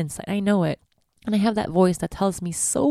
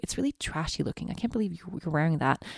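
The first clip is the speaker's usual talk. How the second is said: hushed, scornful inner critic; a soft, cutting voice in her head; 7 dB quieter than usual. Retold or imitated. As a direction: murmur the insult to yourself. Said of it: "this voice tells me".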